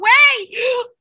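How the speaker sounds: high, loud shocked gasp; sharp gasp. Real, not acted. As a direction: suck in air in shock. Reacting to Paul Heyman scene.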